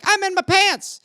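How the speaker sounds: put-on high, baffled child voice; high childish confusion; confused kid. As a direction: play the confused young self. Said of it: "I'd be like".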